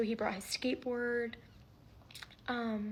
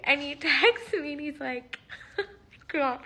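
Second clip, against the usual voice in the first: breaking into real laughter, louder; can't keep straight face; 4 semitones higher, 9 dB louder than usual. Real, not acted. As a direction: try to tell it but crack up. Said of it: "I can't say this with a straight face".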